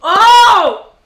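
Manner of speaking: loud high cry of pain; pained shout. Real, not acted. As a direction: yell as the heat hits. Burning from hot sauce.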